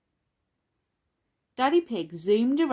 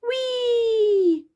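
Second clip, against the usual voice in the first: very high, loud, long squeal; gleeful cry; 7 dB louder than usual. A narrator's reading. George zipping down in the playground, gleeful squeal. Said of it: "cried George".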